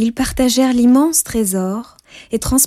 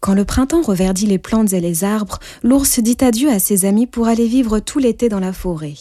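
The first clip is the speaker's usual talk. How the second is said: ordinary delivery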